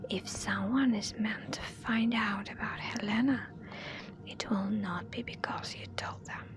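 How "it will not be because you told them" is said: ordinary delivery, nothing marked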